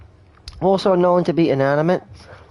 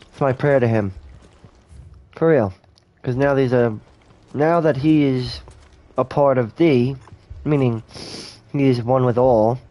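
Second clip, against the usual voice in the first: normal talking voice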